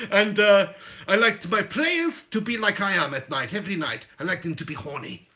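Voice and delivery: hammed-up Dutch-accented English; Louis van Gaal. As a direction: play a saucy Dutch manager. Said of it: "do an L V G impression".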